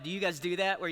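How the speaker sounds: ordinary delivery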